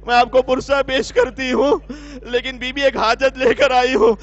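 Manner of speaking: a loud begging cry from a grieving mother; desperate plea. Acted. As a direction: beg the lady to bring her son back. Grieving mother begs Zainab. (غمزدہ ماں کی بلند فریاد)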